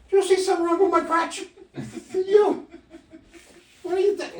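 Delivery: high, loud, fast mock indignation; mock indignant. Husband asks wife about his crotch, high hammed-up outrage.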